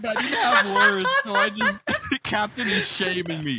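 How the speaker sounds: high giggly laughing speech; words squeezed out through laughter; laughing hard. Real, not acted. Laughing hard after anime voice.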